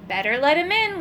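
plain reading voice

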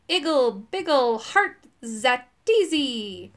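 drawn-out magical incantation; spell casting. A narrator's reading. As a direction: intone it like a wizard's spell, stretching the end. Wizard casting a calming spell.

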